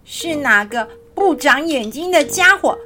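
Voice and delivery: high little hedgehog-like grumble; indignant voice from underfoot. Acted. Stepped-on creature scolds the giraffe, high indignant voice. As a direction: scold the careless walker in a high voice.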